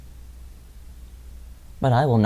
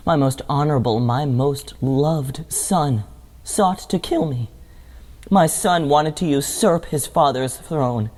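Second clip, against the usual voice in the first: ordinary delivery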